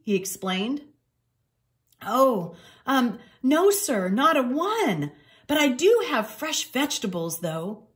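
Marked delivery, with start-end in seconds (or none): 2.0-7.9 pleading cat voice. Acted. Cat answers the clerk.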